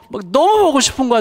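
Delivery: "너무" stressed high and loud in a longing cry. Real, not acted. Father crying out how much he misses his daughter, high and loud. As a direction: cry out "너무" with aching love. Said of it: "막 눈물이 나요".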